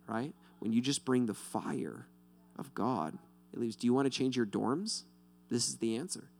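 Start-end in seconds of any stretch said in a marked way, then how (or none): none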